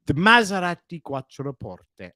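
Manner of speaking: "The Maserati Quattroporte" savoured slowly with Italian vowels, Quattroporte as kwat-tro-POR-te; Italian pronunciation. Shown as a demonstration. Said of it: "Sounds so sexy, so Italian".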